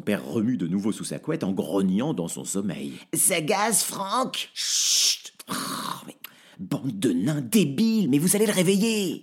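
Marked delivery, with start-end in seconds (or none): 3.1-9.2 high, loud, quick goblin squeal; gleeful little creature yapping; cheeky dwarf. Acted. Dwarves surprise Franck. (voix aiguë de nain espiègle)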